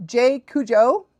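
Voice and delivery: ordinary talk, nothing marked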